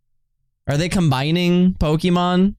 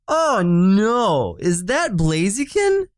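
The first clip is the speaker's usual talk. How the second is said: dismayed surprise; 5 semitones higher, slower than usual. Real, not acted.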